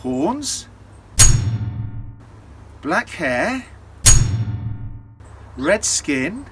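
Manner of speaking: slow, listing innkeeper, rising to a refusal; suspicious innkeeper. Acted. Innkeeper recognising the devil, lightly voiced suspicion building. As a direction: spell out each feature, then turn on him.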